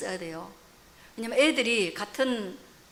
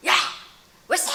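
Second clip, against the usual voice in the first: imitating a father's scolding shout; sudden retold yell; mock angry yell; 2 semitones higher, 5 dB louder than usual. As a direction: bark it like a cross dad, played for laughs. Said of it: "그러면서 막 소리 지르더만".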